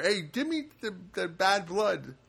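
high, slow mock request; dead husband's plea. Husband bargaining with the blood supplier, drawn-out plea. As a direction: beg for the bad blood, slowly. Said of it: "made a deal with the blood".

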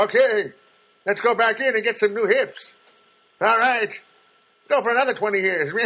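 put-on high, loud creaky old man. Old man telling the surgeon, loudly and high. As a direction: play yourself as a cheerful old guy. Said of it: "That's how I'm gonna be as an old man".

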